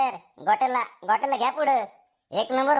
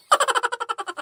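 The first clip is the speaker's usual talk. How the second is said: high-pitched laugh sliding down; gleeful laugh; 11 semitones higher than usual. Acted. Pupil delighted at being sir today, high gleeful laugh.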